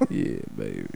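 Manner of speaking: low, soft crooning; smooth radio DJ. Pretend. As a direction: purr it like a radio host. DJ voice again.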